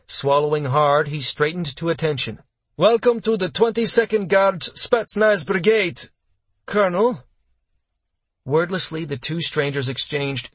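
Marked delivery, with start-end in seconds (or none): 2.8-7.2 stiff, high-pitched military greeting; eager junior officer. Acted. Young captain welcomes a colonel at attention, eager and formal.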